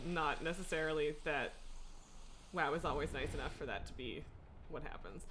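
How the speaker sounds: normal talking voice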